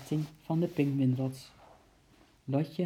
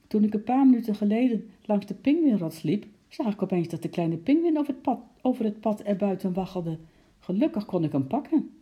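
excited report; 6 semitones higher, 5 dB louder than usual. A narrator's reading. Breathless keeper reports escape.

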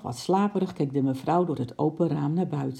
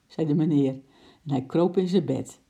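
usual speaking voice

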